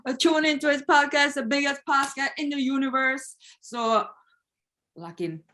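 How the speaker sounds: put-on shouted high Jamaican DJ accent; big up the host in Jamaican patois. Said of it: "start asking people to do their accents".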